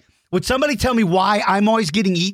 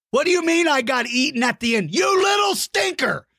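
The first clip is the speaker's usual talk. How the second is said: hammed-up shout, much higher; mock outrage; 9 semitones higher than usual. Host mock-yelling at a kid who drew him eaten. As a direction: play it big, fake-furious at a kid.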